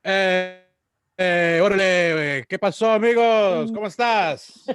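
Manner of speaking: hammed-up Chicano greeting, loud and drawn out; a mock Mexican accent, lightly exaggerated; put-on Mexican accent. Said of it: "I don't actually talk like that at all".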